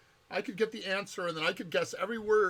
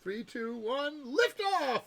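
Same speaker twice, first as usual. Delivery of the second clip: excited countdown; 7 semitones higher, much slower than usual. Pretend. Countdown to liftoff.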